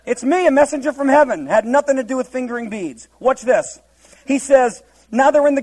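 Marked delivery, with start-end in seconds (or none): 0.1-1.5 higher, louder, friendly hi from an angel; casual greeting. Retold or imitated. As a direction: make it a chummy hello. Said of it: "It was nothing like that".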